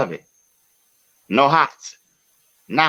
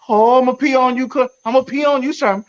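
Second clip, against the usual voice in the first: very high, louder cartoon taunt; Buddy Love voice; 13 semitones higher, 3 dB louder, faster than usual. Voice in his head taunting Sherman, high mocking cartoon voice. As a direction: taunt like a nutty comic villain. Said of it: "hear Buddy in his head".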